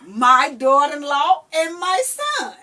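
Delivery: loud, high knowing threat; mock threat. Grandma vowing to call the parents, loud and gleeful. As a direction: promise trouble, gleefully.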